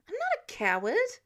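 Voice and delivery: high, loud protest; vehement denial. Acted. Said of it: "Peter denied vehemently".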